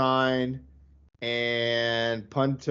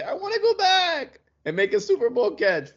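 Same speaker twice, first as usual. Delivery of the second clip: higher, put-on voice for the player; mock player voice; 11 semitones higher than usual. Host mocking a receiver's return, put-on higher voice. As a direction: play the eager receiver begging to come back. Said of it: "go to the Jets and say".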